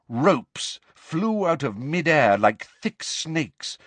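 plain reading voice

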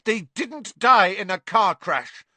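high, loud, furious denial; boy contradicting her in anger; angry Harry. A narrator's reading. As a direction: stand up and say it. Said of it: "said Harry".

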